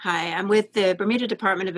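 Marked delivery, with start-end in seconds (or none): none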